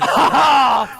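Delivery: loud burst of laughter, high; laughing shout. Real, not acted. Friend bursting out laughing at the pig story, loud laugh. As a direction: crack up loudly at the absurd story.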